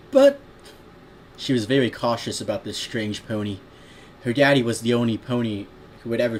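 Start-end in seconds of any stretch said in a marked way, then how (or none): 0.1-0.4 loud, very high filly protest; badly faked child voice; little girl voice. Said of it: "I can't do a good little girl voice".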